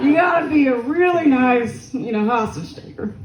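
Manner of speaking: slipping into a country accent. Real, not acted. Comic riffing on a polite hostage taker, country accent. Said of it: "speaking in a country accent".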